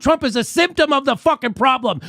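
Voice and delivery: furious yelling at the media; shouted rant. Real, not acted. Ranting at the cable networks, a furious shout. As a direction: let the anger boil over.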